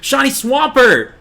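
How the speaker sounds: high, loud, drawn-out cry of excitement; thrilled shout. Real, not acted. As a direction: shout out an amazing find. Spots a rare shiny Pokemon.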